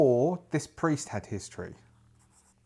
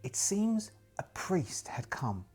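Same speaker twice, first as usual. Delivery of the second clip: whisper; 7 semitones higher, 7 dB quieter, slower than usual. Acted. Whispered line in journal.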